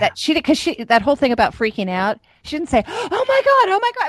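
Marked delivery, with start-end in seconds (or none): 2.9-4.1 high-pitched mock freak-out; mock panic. Retelling what mom didn't say, mock panic.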